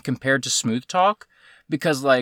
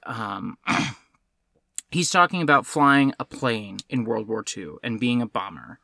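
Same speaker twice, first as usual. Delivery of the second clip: ordinary delivery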